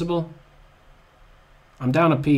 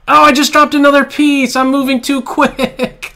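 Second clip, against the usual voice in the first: loud, much higher wail of dismay; distressed outburst; 11 semitones higher, 11 dB louder than usual. Real, not acted. Player who just dropped a piece, loud high wail. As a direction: cry out at the blunder.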